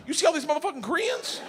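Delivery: high, brash voicing of Sandy; sassy friend. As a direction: toss it out like a blunt new friend. Voicing Sandy's blunt first words.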